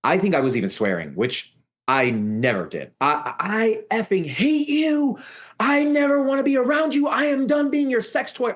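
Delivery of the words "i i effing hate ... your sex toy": high-pitched desperate outburst without real volume; retold scream. Teller breaks down at the abusive woman, retold screaming. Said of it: "I started screaming at the top of my lungs".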